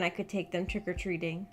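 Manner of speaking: normal talking voice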